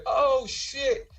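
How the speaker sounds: high startled oh shit; shocked exclamation. Retold or imitated. His reaction while telling his wife.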